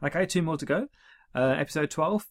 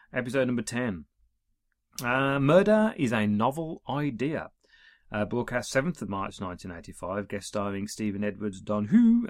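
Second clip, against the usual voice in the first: ordinary talk, nothing marked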